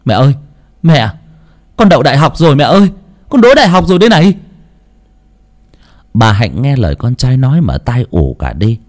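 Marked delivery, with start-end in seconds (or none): 0.0-4.3 high, loud, rushing cry of joy; overjoyed shout. Acted. Son telling his mother he passed university, joyful shout. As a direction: blurt it out breathless with joy. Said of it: "ôm chầm lấy mẹ mà gào lên".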